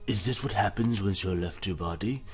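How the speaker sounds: usual speaking voice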